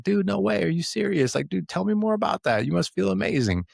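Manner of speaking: put-on light up like your kid just told you the best news ever; excited dude. Dad reacting to his kid's home run, high and thrilled. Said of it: "I was like".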